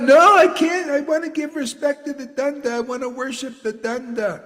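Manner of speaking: high, loud then fading plea; high mock plea; mock pleading disciple. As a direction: beg not to snap it. Imitating a disciple.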